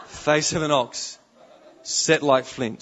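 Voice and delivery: loud, slow declaration; forceful preaching. Real, not acted. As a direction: hammer it out to the congregation. Preacher hammers home the image.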